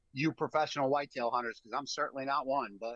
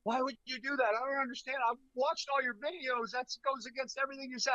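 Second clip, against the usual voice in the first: high-pitched mock protest, speeding up at the end; mimicked upset friend; 10 semitones higher, faster than usual. Friend to guide ignoring his own videos, hammed-up protest. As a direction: imitate a bewildered buddy complaining, voice way up.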